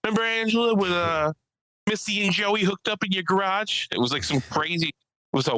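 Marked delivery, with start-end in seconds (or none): 0.0-3.9 high-pitched imitation of her; imitated woman comedian. Woman comic calling out her ex in the audience, imitated. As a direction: put on her voice, pitched well up. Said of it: "She's like".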